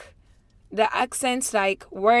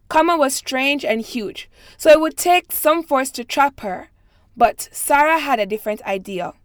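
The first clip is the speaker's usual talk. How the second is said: careful, even read-aloud voice; steady passage reading, a bit higher and brighter than her chat; 3 semitones higher, 5 dB louder than usual. Acted. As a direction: read it like a set text for a recording. Reads the set passage aloud.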